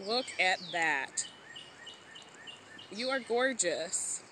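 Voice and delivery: high, slow, tender cooing; cooing at owl. Real, not acted. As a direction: coo softly at a tiny bird.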